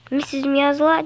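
ordinary talk

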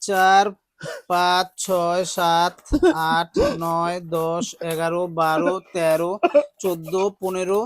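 soft, low steady counting; counting aloud. Real, not acted. Counting donated money for the family, soft and steady. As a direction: count the notes under your breath. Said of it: "counting the money".

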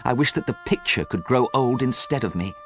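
from hushed grief up to a pleading wish; sad hush, building. A narrator's reading. Young man wishes the portrait aged instead, hushed then fervent. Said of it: "he whispered".

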